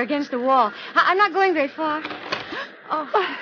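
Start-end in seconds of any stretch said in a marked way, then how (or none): 2.1-2.7 startled gasp; sharp gasp. Acted. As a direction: gasp in shock. Sees the gun.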